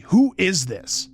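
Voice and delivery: high, slow, sharp demand. A narrator's reading. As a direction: demand an answer, indignant. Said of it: "said sharply".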